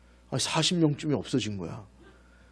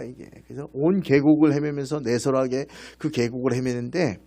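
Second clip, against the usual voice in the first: ordinary delivery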